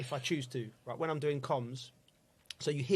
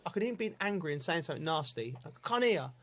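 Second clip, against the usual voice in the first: ordinary talk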